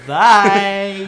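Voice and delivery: loud, high, drawn-out mock goodbye; mock farewell shout. Co-host waves off the boss who storms out, mock shout. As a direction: call it out long and loud, hamming it up.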